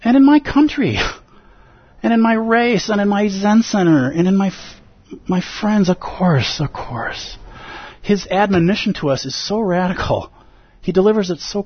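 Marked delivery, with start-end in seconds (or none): 0.0-6.6 higher, eager mock listener voice; imagined listener. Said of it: "you're like". 6.6-7.4 whispered aside. Retold or imitated. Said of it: "you're like".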